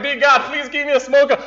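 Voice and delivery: high, pleading little prayer; mock prayer. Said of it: "I was like".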